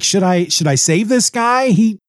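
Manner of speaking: comically torn and unsure; hesitating. Retold or imitated. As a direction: waver aloud over a grudge. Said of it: "he hesitates for a moment".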